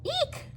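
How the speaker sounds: very high squeal of fright; frightened eek. Acted. Elephant spotting the mouse, shrill squeak. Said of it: "shouted the elephant".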